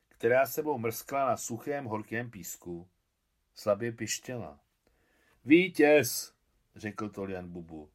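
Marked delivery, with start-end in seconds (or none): 5.4-6.3 cheer. Acted. Boy cheers the catch.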